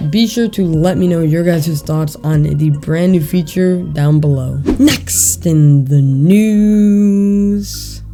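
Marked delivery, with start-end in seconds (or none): 4.8-8.0 slow, stretched announcer call; drawn-out announcer line. Real, not acted. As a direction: savor each word like a segment intro. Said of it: "does it feel good to say that again".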